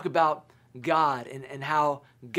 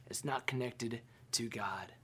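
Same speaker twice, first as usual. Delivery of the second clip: hushed whisper; 4 semitones lower, 10 dB quieter than usual. Acted. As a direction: drop to a whisper on "It's not connected to God.". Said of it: "repeated line, second whispered".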